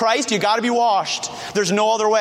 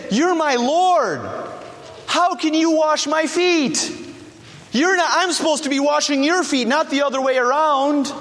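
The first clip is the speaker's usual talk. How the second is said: humble protest; 7 semitones higher than usual. Acted. Preacher voices Peter refusing to be washed by his Lord, humbly protesting. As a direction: object from unworthiness, pitched well up. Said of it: "Peter's actual motivation was humility".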